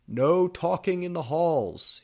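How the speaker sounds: high, loud scolding shout; angry headmaster. A narrator's reading. Dumbledore scolding goths in the hall, loud angry shout. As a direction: bark at the students. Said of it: "started shredding at us angrily".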